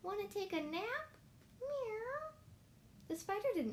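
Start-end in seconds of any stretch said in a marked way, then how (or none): 0.0-2.3 high feline storybook voice; mewing cat. Acted. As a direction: ask like a cat, ending on a meow. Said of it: "cried the cat".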